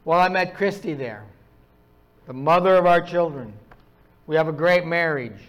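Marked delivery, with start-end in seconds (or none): none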